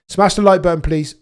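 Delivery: high, loud call to hit like; loud plea. Real, not acted. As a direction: beg the chat for likes. Begging chat for likes.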